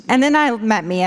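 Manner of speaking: normal talking voice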